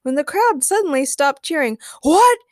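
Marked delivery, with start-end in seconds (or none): 2.0-2.4 sharp, high shouted question; loud, high outburst. A narrator's reading. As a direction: shout it in startled disbelief. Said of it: "shouted Ray".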